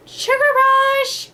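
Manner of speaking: high, slow gleeful cheer; excited kid cheer. Retold or imitated. Her child self cheering a sugar rush.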